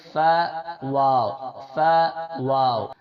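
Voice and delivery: فا واو drawn out slowly with care; demonstrating letter sounds. Shown as a demonstration. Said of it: "آج دو words کا مخرج سیکھیں گے".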